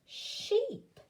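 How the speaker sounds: "sheep" sung out high and falling; delighted teacher reveal; high excited reveal. Shown as a demonstration. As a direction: squeal the word in triumph. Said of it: "Blend them together and it says".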